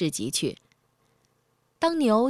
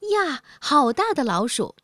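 mock shout of surprise; 6 semitones higher, 3 dB louder than usual. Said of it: "看见的人总会叫".

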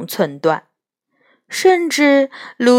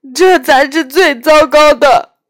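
high, louder, tearful faun; sad whimper; 7 semitones higher, 8 dB louder than usual. A narrator's reading. Faun telling Lucy the worst part, whimpering. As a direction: whimper it in misery. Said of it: "图姆纳斯悲伤地呜咽了一声".

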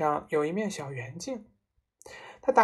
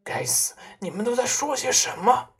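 higher irritated outburst; exasperated cry; 6 semitones higher, 3 dB louder than usual. A narrator's reading. Father clutches his hair before the family, exasperated. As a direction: demand an explanation, rattled. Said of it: "爸爸用两只手抱住头发叫道".

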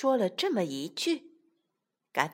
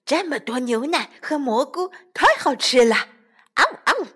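loud greedy munching; wolf it down loudly; gobbling happily; 4 semitones higher, 5 dB louder than usual. Acted. Wolves gobbling food.

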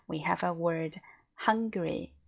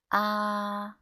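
"Ah" held high and level to the very end; one long, horizontal "Ah"; sustained first tone; 4 semitones higher, 3 dB louder than usual. Shown as a demonstration. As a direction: keep "Ah" steady until it stops. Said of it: "keep the sound to the end".

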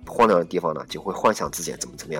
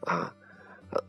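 ordinary talk, nothing marked

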